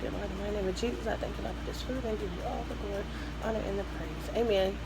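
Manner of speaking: quick, hushed prayer; saying grace. Real, not acted. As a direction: murmur a fast grace over food. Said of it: "let's give God some glory".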